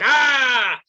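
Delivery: loud, drawn-out scream of mock rage; mock angry yell. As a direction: let out a hammed-up angry "Ah". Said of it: "supposed to be like".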